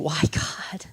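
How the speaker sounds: breathless whisper of a doubting prayer; hushed, unvoiced prayer; whispered question. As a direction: whisper it like a private plea to God. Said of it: "instead of saying".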